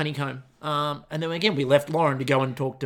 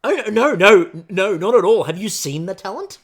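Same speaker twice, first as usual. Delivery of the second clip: loud mock protest; 3 semitones higher, 10 dB louder than usual. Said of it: "she just looks around and goes".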